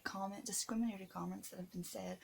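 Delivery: ordinary talk, nothing marked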